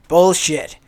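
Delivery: loud, scornful outburst; scoffing shout. Acted. Apollo scoffing loudly at Ares claiming the throne. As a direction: blurt it out, mocking his boast.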